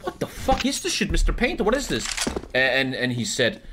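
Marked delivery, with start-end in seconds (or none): 0.0-2.1 much higher, fast, appalled wife voice; shriek-lite disbelief at the canvas; outraged wife. Retold or imitated. Widow sees the obscene painting, high and appalled. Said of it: "the wife is like".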